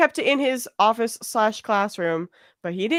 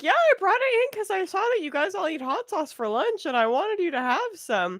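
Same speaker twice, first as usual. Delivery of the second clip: high, sugary imitation of the colleague; sweet colleague voice; 9 semitones higher, 4 dB quieter than usual. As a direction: play the innocent giver, lightly. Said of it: "she's over here like".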